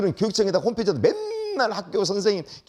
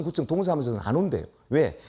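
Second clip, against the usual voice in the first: ordinary talk, nothing marked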